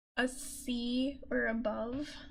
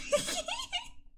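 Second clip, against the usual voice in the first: high excited giggle. Real, not acted. Excited laugh.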